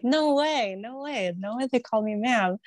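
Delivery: high, squealing no way, no way; giddy, can't believe it; excited disbelief. Retold or imitated. Friend to friend, thrilled at being called ma'am, squealing. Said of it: "I was like".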